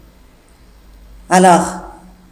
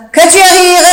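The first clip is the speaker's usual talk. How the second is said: raised, louder scolding question; annoyed master; 8 semitones higher, 6 dB louder than usual. Acted. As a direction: demand an answer, irritated. Jourdain scolds laughing maid.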